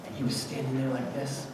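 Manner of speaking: ordinary talk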